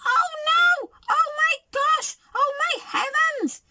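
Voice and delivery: very high squealing little bear; wee little voice. A narrator's reading. Baby Bear squeals in the kitchen, wee little voice. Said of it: "he cried in his wee little voice".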